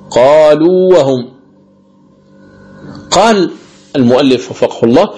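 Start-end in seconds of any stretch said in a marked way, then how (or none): 0.1-1.3 قالوا وهم recited slowly, a long held ū before a clear waw; madd al-tamkin. Shown as a demonstration. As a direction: sustain the end of قالوا and start وهم without merging. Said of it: "بين الواوين أو الياءين".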